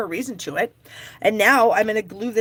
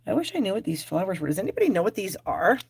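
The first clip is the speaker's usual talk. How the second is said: normal talking voice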